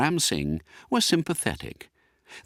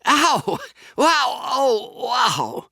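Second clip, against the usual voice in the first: high yelping cries of sudden pain; howl of pain; 4 dB louder, much slower than usual. Acted. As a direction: yelp it out, a sharp jolt of pain. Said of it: "or rather, a howl".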